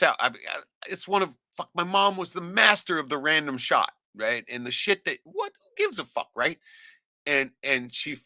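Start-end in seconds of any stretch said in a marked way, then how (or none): none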